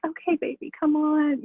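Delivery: high, sugary mock coaxing; mock sweet. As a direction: coo it up high like a fake sweetheart. Mocking the ex's sweet talk.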